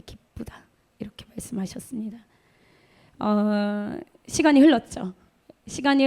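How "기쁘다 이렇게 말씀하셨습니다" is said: breathy moved whisper trailing off; choked whisper. Real, not acted. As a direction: whisper it, overcome with emotion. 감동으로 속삭이듯. (감동에 목이 메어 속삭이듯)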